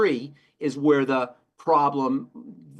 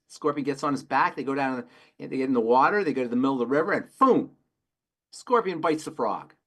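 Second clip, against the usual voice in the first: ordinary talk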